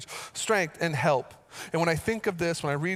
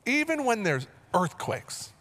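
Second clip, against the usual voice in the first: full-force preaching; 6 semitones higher than usual. Acted. Pastor declaring to the church he will not fear, fervent preaching. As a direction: preach it with conviction.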